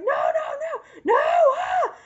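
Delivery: high-pitched mock panicked scream; screaming his head off. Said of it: "screaming his head off".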